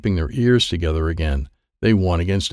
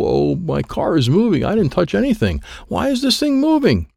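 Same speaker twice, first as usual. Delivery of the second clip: rising panic; 10 semitones higher than usual. Acted. Kid's race car starts moving by itself, panicked. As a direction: pitch climbing high in alarm.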